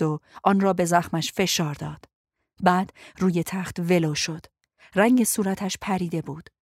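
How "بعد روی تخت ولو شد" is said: plain reading voice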